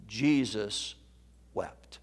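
soft with a long pause between the words; hushed, halting. Real, not acted. Preacher quoting the shortest verse, hushed. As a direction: let each word fall quiet and alone.

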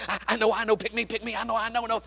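a hint of excited schoolkids; eager children. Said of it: "Two hundred boys and girls".

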